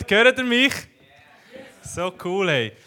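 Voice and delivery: normal talking voice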